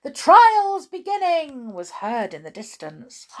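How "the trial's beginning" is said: high, loud far-off call; distant cry. A narrator's reading. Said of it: "a cry of".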